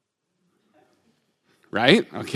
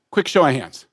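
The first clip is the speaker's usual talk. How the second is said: usual speaking voice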